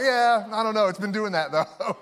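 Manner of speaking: awkward play-off. Retold or imitated. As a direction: bluff it off awkwardly. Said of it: "you're like".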